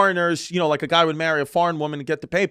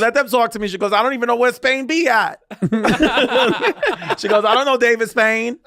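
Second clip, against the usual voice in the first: high, loud, giggling sass; laughing mock mom; 7 semitones higher, 4 dB louder than usual. Retelling the mom's defiance, loud and laughing. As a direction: lift the voice, laugh through it. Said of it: "she was like".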